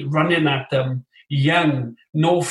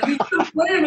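hammed-up higher foreign voice teasing; lightly mock the old defender's cheeky jab; mock Italian defender; 12 semitones higher than usual. Player mimics the veteran Italian defender taunting him in a mock voice.

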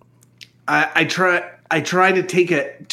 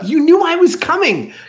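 put-on disgusted young voice; 11 semitones higher, 3 dB louder than usual. Flea sees naked Archie.

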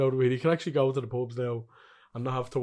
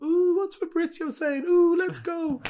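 much higher, slow, giddy fanboy squeal; mock transfer-obsessed fan; 4 dB louder, slower than usual. Mocking co-host as an eager transfer fan, squeaky high voice. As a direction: tease him as an overexcited fan refreshing Twitter. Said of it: "constantly updating".

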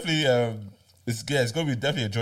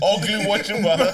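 laughing; 10 semitones higher, 7 dB louder, slower than usual. Real, not acted. Laughing repeat.

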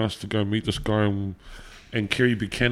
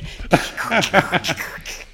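louder mouth-made DJ scratches; turntable scratching noises; 9 semitones higher, 5 dB louder than usual. Shown as a demonstration. DJ repeats his scratching on the host's request, scratching noises. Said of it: "Do that again".

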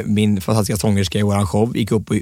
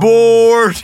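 very loud, high held shout; retold heckler scream; 13 semitones higher, 9 dB louder than usual. Imitating a guest interrupting every speech, screamed. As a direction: holler it out high and long. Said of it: "någon som skrek".

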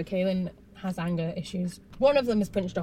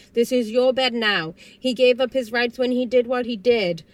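louder, higher, emphatic quote; firm declaration; 4 semitones higher, 7 dB louder than usual. Retold or imitated. Recapper voices Janet telling Kaylin about the bed, firm. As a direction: lay down the law as an imitated mother.

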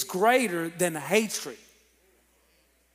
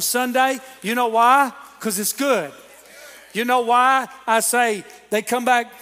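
louder, higher, driving sermon delivery; fired-up preaching; 4 semitones higher, 5 dB louder than usual. Real, not acted. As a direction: preach it with rising momentum.

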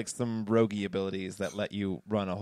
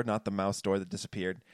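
ordinary talk

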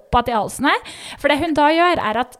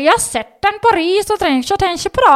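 doctor in a thick Gudbrandsdal dialect; 8 semitones higher, 5 dB louder than usual. Retold or imitated. Doctor instructing the patient, Gudbrandsdal dialect impression. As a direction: put on the Gudbrandsdal dialect, a bit louder. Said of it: "sånn gudbrandsdalsdialekt".

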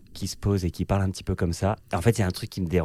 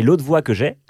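pitched up, a bit louder, eager to please; higher perched voice; 5 semitones higher, 5 dB louder than usual. Shown as a demonstration. Guest showing his higher, people-pleasing everyday voice. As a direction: lift into the polite social voice. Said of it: "un peu plus perchée".

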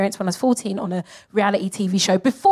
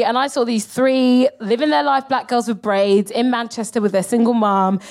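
normal talking voice

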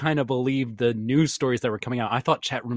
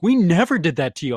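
upset dad; 4 semitones higher, 4 dB louder than usual. Retold or imitated. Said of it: "My dad was so upset".

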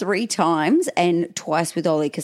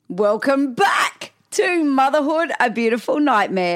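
big drawn-out welcome, pitched up; show-opening announcement; 6 semitones higher than usual. Real, not acted. Host welcomes listeners back to Motherhood, excited announcement. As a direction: launch the show with a stretched, raised hello.

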